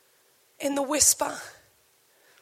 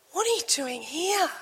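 quieter, stress on are; soft question; 2 semitones higher, 6 dB quieter than usual. Acted. God questioning the church, soft and searching. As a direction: ask it softly and searchingly.

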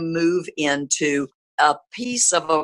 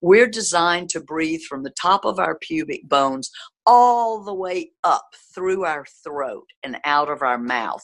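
ordinary delivery

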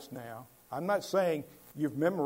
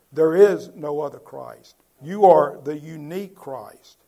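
loud, ringing preacher's declaration; booming pulpit emphasis; emphatic proclamation; 13 dB louder than usual. Real, not acted. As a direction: proclaim it with full force. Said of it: "It's emphatic in the Greek".

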